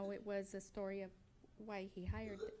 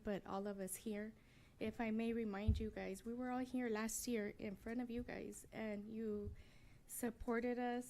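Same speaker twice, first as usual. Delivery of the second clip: normal talking voice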